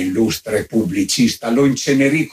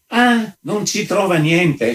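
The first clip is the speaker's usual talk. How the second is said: high, drawn-out indignant echo; incredulous, stretched-out outburst; blustering; 8 semitones higher, slower than usual. A narrator's reading. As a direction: throw it back slowly in mock outrage. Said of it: "strepitò l'altro".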